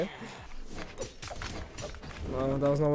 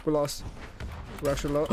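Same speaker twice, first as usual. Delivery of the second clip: ordinary talk, nothing marked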